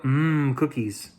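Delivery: ordinary delivery, nothing marked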